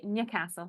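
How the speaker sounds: "Newcastle" said the Geordie way, nyuh-CASSEL; demonstrating "Newcastle" as locals say it, nyi-CASSEL; local Newcastle pronunciation. Shown as a demonstration. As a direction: say "Newcastle" with a short flat a and a squashed first syllable, nyi-CASSEL. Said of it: "You have to say it correctly".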